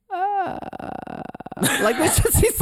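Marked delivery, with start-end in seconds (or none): 0.1-0.6 high strained whimper; brief high wavering sound; mock shaky gasp. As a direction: imitate a nervous little gasp. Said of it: "you kinda hear this".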